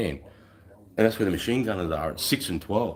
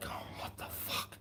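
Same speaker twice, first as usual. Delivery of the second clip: startled whisper; whispered confusion; whispered what the fuck; 15 dB quieter, slower than usual. Retold or imitated. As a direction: hiss it out, shocked and low. Guy's startled reaction retold.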